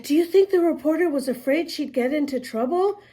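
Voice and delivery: lightly voiced little girl, worried; a hint of a girl asking fast. A narrator's reading. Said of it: "The little girl said".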